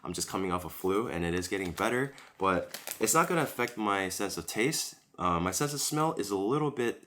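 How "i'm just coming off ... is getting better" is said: ordinary talk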